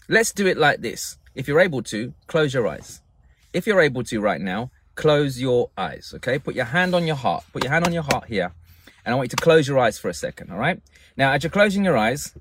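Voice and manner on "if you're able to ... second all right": usual speaking voice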